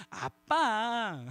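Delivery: 아주 길게 늘여 부드럽게 '아빠'; 애원하듯 길게 끌어; 애교 있게 조르기. Shown as a demonstration. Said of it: "뭐 이렇게 부르지 않겠어요?". (용돈을 바라는 아이가 길게 늘인 부름)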